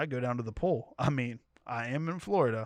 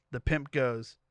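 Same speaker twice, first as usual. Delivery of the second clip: usual speaking voice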